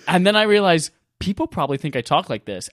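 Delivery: normal talking voice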